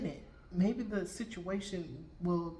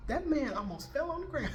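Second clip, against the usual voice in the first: high, amused, laughter in the voice; laughing; 9 semitones higher than usual. Real, not acted. Storyteller recalling the stranger's shock, laughing. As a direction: tell it while cracking up.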